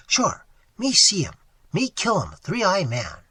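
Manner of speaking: loud, proud broken English; boastful Indian. A narrator's reading. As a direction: boast it loudly.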